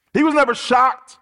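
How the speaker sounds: NEVER shocked, hit hard and loud; forceful preacher's emphasis. Acted. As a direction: drive "never shocked" home to the congregation. Restates the point with force.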